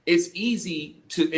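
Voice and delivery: usual speaking voice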